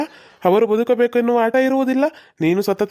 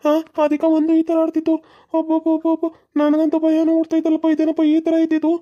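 a much higher, rattled villager voice; frightened husband; 8 semitones higher than usual. Acted. Husband telling how his wife was possessed, high and rattled. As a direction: tell it still shaken by the wife's possession.

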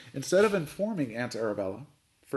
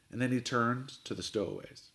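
plain reading voice

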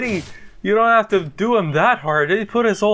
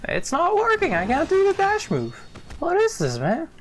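frustrated whine; 10 semitones higher than usual. Real, not acted. Frustrated at game controls.

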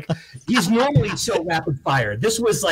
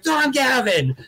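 nervous host intro; 8 semitones higher, slower than usual. Retold or imitated. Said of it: "I'm like".

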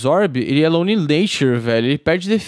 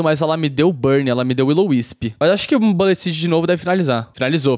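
normal talking voice

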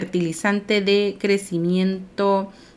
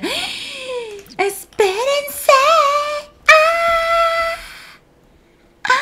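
very high, drawn-out squeal; excited squeal; much slower than usual. Real, not acted. Player seeing a cute outfit, delighted squealing.

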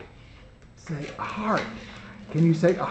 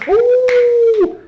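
wolf howl; 13 semitones higher, 3 dB louder than usual. Acted. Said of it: "a wolf is, is calling in the woods".

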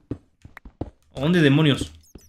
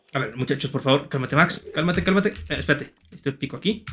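hushed, tense; 6 semitones lower, 7 dB quieter than usual. Real, not acted. Calming himself under threat.

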